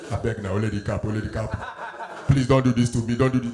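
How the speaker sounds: low, pleading man's voice; mock begging. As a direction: beg low and desperate.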